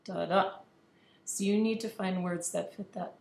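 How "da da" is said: "Da-da" with weak first, strong second syllable; unstressed then stressed "Da-da", stepping up; iamb stress pattern. Shown as a demonstration. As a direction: punch only the second "da" of "Da-da". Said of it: "an unstressed plus a stressed syllable".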